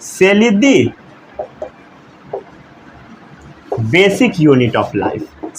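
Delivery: very slow, words spaced for copying; slow dictation. Shown as a demonstration. Teacher repeating the definition slowly for note-taking. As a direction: read it out slowly so they can write. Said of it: "क्या बोला गया?".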